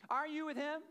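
put-on young girl's voice. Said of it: "A third time".

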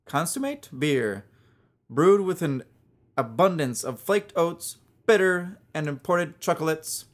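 put-on hammy accent of no clear country; muddled put-on accent. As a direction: read it in a vague mixed-up accent. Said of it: "why'd you go accent there".

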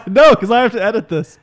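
said high and loud, still laughing; a loud laughing comeback, way up high. Real, not acted. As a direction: laugh through the retort. Laughing retort.

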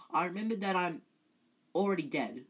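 ordinary delivery, nothing marked

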